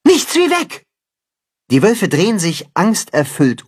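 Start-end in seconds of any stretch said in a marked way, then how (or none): 0.1-0.9 panicked high cry. A narrator's reading. Wolves flee in fear.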